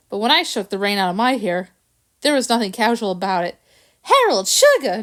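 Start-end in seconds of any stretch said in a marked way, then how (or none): 4.0-5.0 a high, loud cry of alarm; cried out. A narrator's reading. Georgette crying out as the dog shakes off rain. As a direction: cry out to stop the shaking. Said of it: "Georgette cried".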